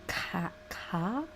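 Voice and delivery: trying out a regional "car"; Pennsylvanian pronunciation. Shown as a demonstration. Puzzling over Pennsylvania's way of talking, regional pronunciation. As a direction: say "car" as a questioning imitation of Pennsylvania speech. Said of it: "What a fucking way to pronounce it".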